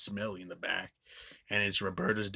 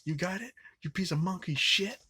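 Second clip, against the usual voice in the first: raised, pitched-up voice of a sneering thug; high mock threat; 10 semitones higher, 4 dB louder than usual. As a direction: spit it out as a cocky bully. Quoting a character's threat.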